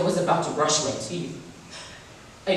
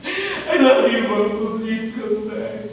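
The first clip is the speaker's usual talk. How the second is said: sobbing plea; 5 semitones higher than usual. Acted. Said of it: "I broke down".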